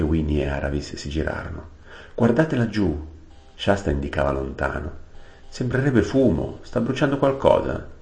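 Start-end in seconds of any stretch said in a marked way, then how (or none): 2.2-3.0 urgent call. Acted. Shasta pointing far off. 5.5-7.9 high, worried voice; boy seeing smoke on the horizon, worried; alarmed. Acted.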